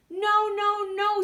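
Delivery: high, squeaky little wolves; squeaky wolves refusing. Acted. Little wolves squeak their refusal to the pig. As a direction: pipe it up high like tiny wolves.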